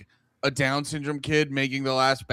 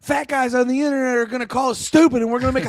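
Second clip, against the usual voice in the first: high, raised, exasperated mock yell; mock bossy son; 8 semitones higher, 4 dB louder than usual. Son pushing his mother between takes, mock impatient shouting. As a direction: holler orders in a hammed-up high voice. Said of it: "he's like".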